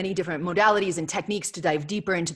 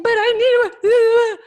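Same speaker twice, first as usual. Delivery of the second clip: put-on much higher, slow whiny child voice; imitating her daughter; 11 dB louder, much slower than usual. Mother voicing her four-year-old interrupting a Zoom call, high and whiny. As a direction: play a small child pleading. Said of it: "she's like".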